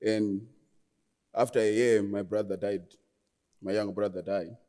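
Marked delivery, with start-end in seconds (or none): none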